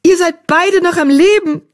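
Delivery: high, elated exclamation; excited cry. Acted. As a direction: cry out in joyful relief. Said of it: "rief Blattpfote".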